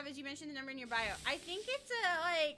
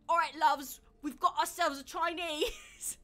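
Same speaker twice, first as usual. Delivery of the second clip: slow, mock British accent, louder on all right; 5 dB louder, slower than usual. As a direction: imitate a cheery British girl in a British accent. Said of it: "the way the girl says it".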